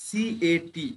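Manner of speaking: drawn-out spelling for students; teacher spelling CAT slowly. Shown as a demonstration. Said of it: "যেমন CAT".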